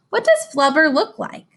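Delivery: ordinary delivery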